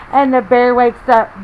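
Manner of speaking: put-on lively read-aloud to children; story reading. A narrator's reading. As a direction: read it like a picture book for little ones. Reading picture book to kids.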